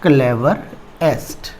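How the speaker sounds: drawn out very slowly; slow dictation. Shown as a demonstration. Teacher dictating the superlative slowly to students. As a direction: stretch the word for note-taking. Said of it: "clever होगा".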